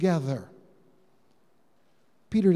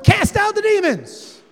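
high, loud, drawn-out battle cry; rallying shout; 11 semitones higher, 7 dB louder than usual. Retold or imitated. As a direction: shout it like an eager cheer. Disciples' eager rallying cry.

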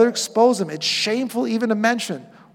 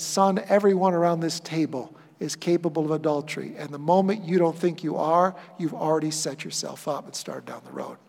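softer, grave fatherly counsel; quiet fatherly warning; 6 semitones lower, 9 dB quieter than usual. Retold or imitated. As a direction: quietly warn the young man like a father. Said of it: "looks at him and said".

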